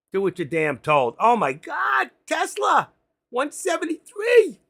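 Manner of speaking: furious yelling. Real, not acted. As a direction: scream at the crashing screen. Market crashing, trader yelling.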